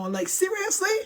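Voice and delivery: high-pitched disbelieving question; incredulous, voice lifting high. Real, not acted. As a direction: pitch jumps up in real disbelief. Incredulous question about the boyfriend knowing.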